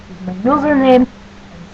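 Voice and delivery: put-on, mock Russian-style accent; funny accent. Doing an accent challenge for viewers, funny put-on Russian-style accent. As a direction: answer everything in a comic Russian-style accent. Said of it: "I answer in a funny accent".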